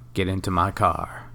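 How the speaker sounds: normal talking voice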